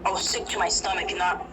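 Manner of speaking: plain reading voice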